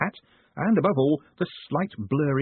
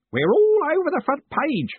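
louder, high proud announcement; proud old Groat; 6 dB louder than usual. A narrator's reading. Old Groat proudly telling his boss, loud and high. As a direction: boast it to the boss. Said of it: "said Groat proudly".

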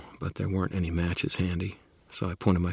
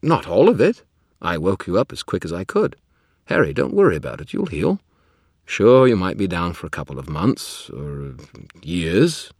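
defensive; 2 semitones higher, 9 dB louder than usual. Acted. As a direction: talk fast to reassure, defensively. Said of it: "defensive look".

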